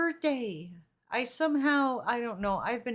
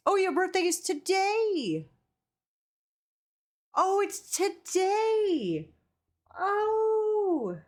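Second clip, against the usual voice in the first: surprised; 6 semitones higher, slower than usual. Real, not acted. Realizing birthday is today.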